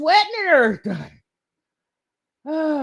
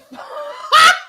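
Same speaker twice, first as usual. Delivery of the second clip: long, high, loud laughing fit; overwhelmed laugh; 8 semitones higher, 6 dB louder than usual. Real, not acted. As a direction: burst into helpless, whooping laughter. Overwhelmed by a surprise gift.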